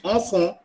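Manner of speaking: "enfant" as on-fon, final T silent; French pronunciation. Shown as a demonstration. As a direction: say "enfant" the French way, nasal vowels, no T. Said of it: "but it's a enfant". ("enfant" का French उच्चारण, "ऑंफ़ों")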